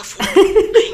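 high, loud, laughing blurt; laughing burst. Retold or imitated. Cutting in on the old man's photo claim, laughing high and loud.

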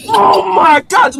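high, drawn-out wail of protest; pained, high-pitched exclamation; distressed outcry. Real, not acted. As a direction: cry out high and stretched. Reacts to being smacked.

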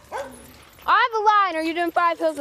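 loud, high, drawn-out call. Real, not acted. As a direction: stretch the call across the field. Coach calling runners to start.

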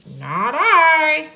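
high, drawn-out duck voice; storybook duck. A narrator's reading. Duck refuses to help water, high character voice. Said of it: "said the duck".

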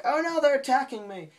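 high, loud cry of alarm; hammed-up cartoon cry for help; mock panic. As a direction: imitate a boy under attack. Acted panic at butterfly attack.

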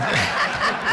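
short breathy laugh before the quote; a quick unvoiced laugh; burst of laughter. Laughing while retelling the quote.

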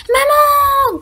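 high loud scared call; boy yelling for mum in fright; frightened child's cry. A narrator's reading. A scared boy yells for his mum at two lions. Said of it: "crie le petit garçon, effrayé".

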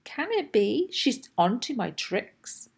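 usual speaking voice

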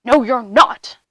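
loud, blunt troll refusal; menacing troll. A narrator's reading. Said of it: "said the troll".

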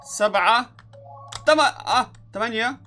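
high, loud excited count. Real, not acted. Counting the waves aloud, shouting each number excitedly.

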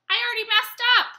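loud, high exclamation; sudden self-correction. Real, not acted. Singer catches own slip mid-song, sudden self-correction.

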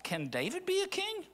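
high, soft questioning voice; lightly voiced confused Samuel; doubting Samuel. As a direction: raise it up as a puzzled prophet.

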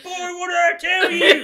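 hammed-up Southern dad shout, high and drawn out; yelling Southern man. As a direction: bellow it slowly as a furious Southern father. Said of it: "you turned into a Southern man".

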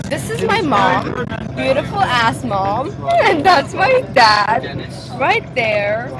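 normal talking voice

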